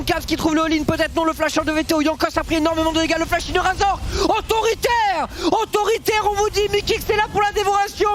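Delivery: fast, high play-by-play; esports caster. Real, not acted. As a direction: call the fight live at speed. Live play-by-play fight call. (commentateur esport en direct)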